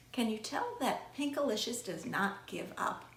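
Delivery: plain reading voice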